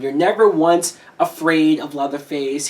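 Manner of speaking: ordinary delivery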